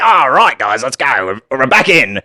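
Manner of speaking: hammed-up Aussie rallying the lads; Australian accent returning. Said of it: "comes right back".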